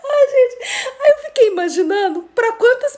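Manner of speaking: high, giggly, easing down; real laughter spilling into the words; laughing while talking. Real, not acted. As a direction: catch yourself after a laughing fit. Recovering from laughing fit.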